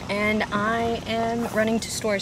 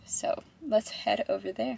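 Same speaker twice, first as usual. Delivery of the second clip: soft whispered aside; whispering; 8 dB quieter than usual. Real, not acted. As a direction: drop to a whisper. Whispered aside while driving.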